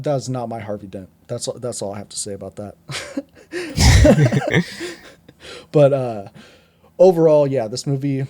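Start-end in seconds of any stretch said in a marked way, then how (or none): 3.5-4.9 sudden high-pitched laugh bursting out; high burst of laughter. Real, not acted. Reviewer laughing at his own Harvey Dent rant, a high burst of laughter. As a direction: laugh out loud, high and free.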